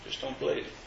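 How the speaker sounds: whispered, scornful brush-off; dismissive whisper. Retold or imitated. Preacher mocks the sceptic who waves faith away, whispered scorn. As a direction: breathe it out like a shrugging sceptic.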